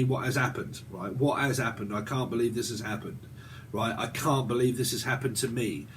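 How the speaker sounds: a lightly voiced whiny victim, dropped soft; understated pity-me moan; mock self-pity. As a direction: mimic a self-pitying victim, half-mocking. Mocking someone playing the victim.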